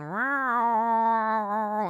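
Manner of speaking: long loud drawn-out cat yowl; imitated cat yowl. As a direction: yowl like an angry cat protesting. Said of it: "he starts going".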